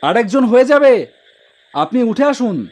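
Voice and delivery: a loud, high call across the street; shouting. Acted. Toto driver shouts to a woman standing far off, loud. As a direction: yell it out so she hears you from far off. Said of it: "আমি চিৎকার করে বললাম".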